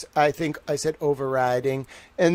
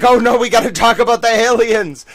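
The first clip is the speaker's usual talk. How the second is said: mock alarmed Obama; 8 semitones higher, 11 dB louder than usual. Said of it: "Super Obama's like".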